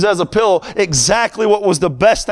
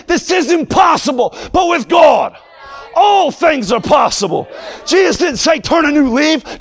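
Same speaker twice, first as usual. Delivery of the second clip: preaching at full force; 8 semitones higher than usual. Acted. Preacher rousing his congregation toward rebirth, shouting, full force. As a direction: thunder it at the congregation like a revival preacher.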